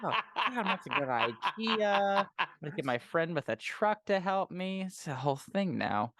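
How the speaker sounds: lightly voiced panicky villain, laughing; a hint of a flustered villain fussing over cleanup; fretful villain. Voicing the villain after a kill.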